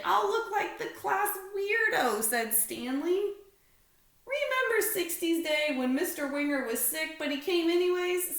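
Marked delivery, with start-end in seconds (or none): 0.0-2.2 high, loud worried complaint; Stanley's whine. A narrator's reading. Stanley fearing he'll look weird, high and loud. 4.3-8.4 put-on high, loud kid voice; Larry reminding. A narrator's reading. Larry reminding Stanley of sixties Day, high and loud.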